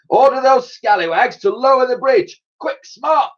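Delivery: high, loud commanding admiral; barked order. Acted. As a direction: bark the order at the crew. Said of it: "ordered the Admiral".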